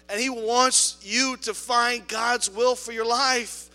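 loud, rising preacher's call, hammering needed; rally every group in the room; preaching at full force. Acted. Pastor rallies the church members, preaching at full force.

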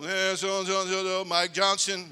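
a hint of a drawling teacher calling roll; speech teacher voice. Teacher calling roll in class, lightly voiced. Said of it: "He's doing the role".

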